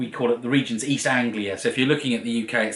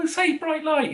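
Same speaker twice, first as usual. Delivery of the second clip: high-pitched mimicry of cousins' request; mock high cousin voice, egging someone on; imitating teasing cousins. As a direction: put on a high voice for pestering cousins. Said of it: "my cousins used to".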